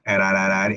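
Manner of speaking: ararara with a soft tapped R; soft Spanish R drill. Shown as a demonstration. Teacher demonstrating ararara with a soft tapped R. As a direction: tap the R lightly on each syllable. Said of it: "Esa es la R suave".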